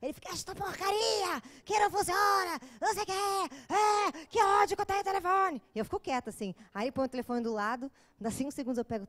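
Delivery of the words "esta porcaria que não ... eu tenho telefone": boyfriend's furious fit, higher and hammed-up; mock angry rant. Boyfriend raging at his phone that won't play music, mock angry rant. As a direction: imitate an impatient, high-pitched tantrum. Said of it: "se ele tá ansioso pra botar música".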